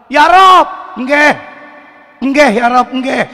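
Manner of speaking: tiruan logat Jawa, keras dan tinggi; doa orang Jawa. Retold or imitated. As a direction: meniru orang Jawa berdoa, nggih ditekan. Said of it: "Orang Jawa baca doa". (logat Jawa yang dilebih-lebihkan)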